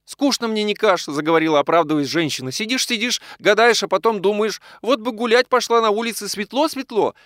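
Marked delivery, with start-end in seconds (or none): 0.0-1.0 high-pitched woman's complaint; apologetic high whine; plaintive wife. A narrator's reading. Sick wife complains, justifying herself. 2.5-7.1 high, wistful woman's voice; high-pitched apologetic lament; plaintive wife. A narrator's reading. Sick wife complains, justifying herself.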